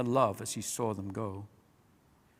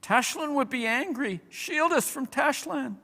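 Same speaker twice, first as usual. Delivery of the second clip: much higher small fearful voices; frightened small animals; 12 semitones higher than usual. A narrator's reading. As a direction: plead like scared little creatures.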